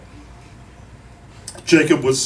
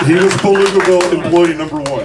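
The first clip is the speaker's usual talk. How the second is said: laughing; 2 semitones higher, 7 dB louder than usual. Real, not acted. Eulogy joke, crowd and speaker laughing.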